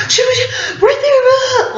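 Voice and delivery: very high, slow, half-laughing half-crying shout; a retold shriek, shaky between laughing and crying; frantic cry. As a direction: blurt it through terrified tears. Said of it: "Nothing was coming out right".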